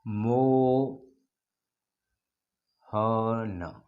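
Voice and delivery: मोहन held very long on the final vowel; a long, ringing call of a name; drawn-out call. Shown as a demonstration. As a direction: call मोहन and let the end ring out. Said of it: "यहाँ समझिए".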